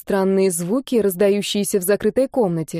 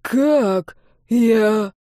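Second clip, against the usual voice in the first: stunned question breaking off unfinished; choking bewilderment; 3 semitones higher than usual. A narrator's reading. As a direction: falter in dying disbelief. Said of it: "Он захлебнулся словами".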